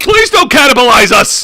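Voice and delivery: loud mock plea; pleading shout. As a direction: beg loudly. Pleading to Warner Discovery.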